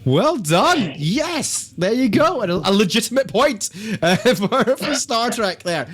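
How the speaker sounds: laughing, delighted quizmaster; excited. Real, not acted. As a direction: cheer the point like a game-show host. Celebrating a correct quiz answer.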